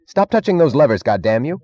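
high, loud outburst; frantic angry order. A narrator's reading. Stadler ordering Meggs off the levers, frantic anger. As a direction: burst out to stop them.

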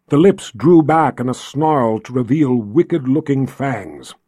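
ordinary delivery

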